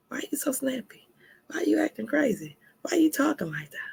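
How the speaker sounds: mock whisper, sharp and questioning; whispered accusing questions; whispered nagging questions. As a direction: hiss the questions like a suspicious partner. Mimicking a partner's nagging questions.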